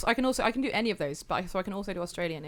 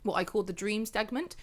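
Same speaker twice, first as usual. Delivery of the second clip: ordinary talk, nothing marked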